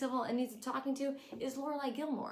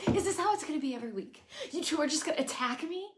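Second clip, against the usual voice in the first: higher, louder, fast whiny complaint; hammed-up tantrum from a grown daughter; mock wounded outburst; imitating Lorelai; 7 semitones higher, 4 dB louder, faster than usual. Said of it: "she says".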